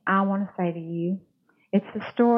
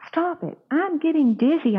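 mock scream; 8 semitones higher than usual. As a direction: cry out as if spinning out of control. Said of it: "I screamed".

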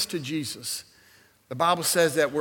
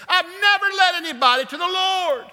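excited; 13 semitones higher, 4 dB louder than usual. Real, not acted. Excited about leading someone to faith.

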